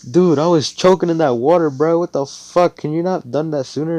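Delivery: high, loud indignant complaint; indignant outburst. Acted. Magna, just released from choking in water, indignant. Nearly drowned character complains loudly.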